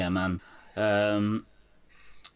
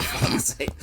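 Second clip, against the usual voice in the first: whispered. Retold or imitated. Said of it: "And he's just like".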